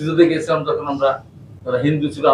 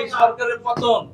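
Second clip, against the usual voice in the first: slow, high, drawn-out shout; rally shout; 6 semitones higher, 3 dB quieter, slower than usual. Acted. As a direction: stretch it out for the crowd to finish. Rally climax, crowd answers.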